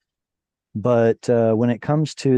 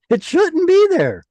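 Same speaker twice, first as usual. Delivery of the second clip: high squeaky indignant cry; mock outrage; 6 dB louder than usual.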